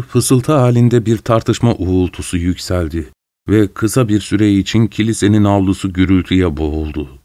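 ordinary delivery, nothing marked

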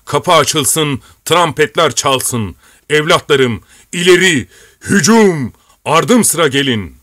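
high, loud rallying cry; commander's ringing charge; shouted battle order. A narrator's reading. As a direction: call your men to charge, building to a peak. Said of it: "diye bağırdı".

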